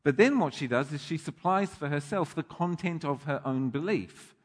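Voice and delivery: ordinary talk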